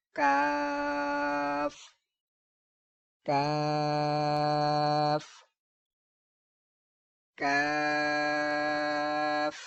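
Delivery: کاف held long on a light "kaa"; thin, light sound. Shown as a demonstration. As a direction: intone کاف thin, not heavy, very drawn out. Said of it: "اس کو باریک پڑھنا ہے، اس کو موٹا نہیں پڑھنا".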